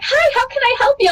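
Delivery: high, sweet shop-counter greeting; imitating merch lady. Merch lady greeting a foreign fan, high and sweet. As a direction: chirp it like a friendly clerk.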